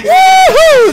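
loud high cheer; excited whoop. Real, not acted. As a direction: cheer loudly for a fan. Greeting a top fan.

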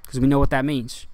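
normal talking voice

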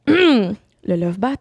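very slow breathy purr; voix sensuelle. Shown as a demonstration. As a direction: draw it out seductively slow. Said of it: "ma voix sensuelle". (lent et sensuel, en ronronnant)

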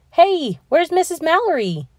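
calling out. Acted. Matt calls out looking for teacher.

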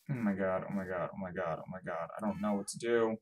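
low, soft repeated dismay; helpless muttering. Real, not acted. Player not knowing what to do, quiet dismay. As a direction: drop to a small, stunned murmur. At a loss after screaming.

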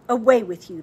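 dismissive. A narrator's reading. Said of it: "the hag said".

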